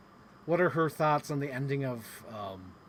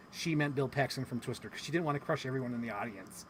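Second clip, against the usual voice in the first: ordinary talk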